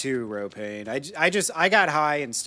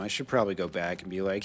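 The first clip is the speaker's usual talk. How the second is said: normal talking voice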